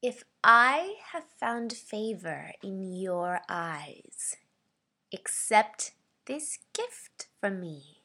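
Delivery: slow, stretched-out menacing voice; sinister, menacing. Shown as a demonstration. Voice actor's second audition read of offering a gift, sinister menace. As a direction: draw each phrase out with threat. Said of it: "this is my sinister slash menacing read".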